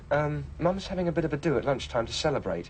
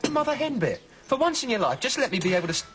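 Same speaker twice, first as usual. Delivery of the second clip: much higher, rising in frustration; exasperated outburst; 10 semitones higher than usual. Acted. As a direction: snap at an overprotective mum. Son snaps at fussing mother.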